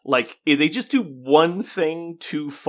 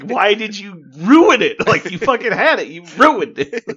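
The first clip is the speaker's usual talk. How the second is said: mock lament; 9 semitones higher, 10 dB louder than usual. Griping that the designer ruined his own game, loud mock anguish. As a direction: howl it like the game was ruined. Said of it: "he's like".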